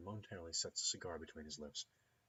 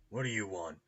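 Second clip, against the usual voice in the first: curt, louder intercom voice; brusque; 3 semitones higher, 8 dB louder than usual. A narrator's reading. Doorkeeper answering a stranger through the intercom, brusque. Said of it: "a brusque voice answers".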